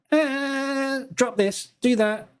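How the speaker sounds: little alien voice, high and bossy. Retold or imitated. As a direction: play the tiny alien pulling the levers. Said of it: "little alien sitting in his head".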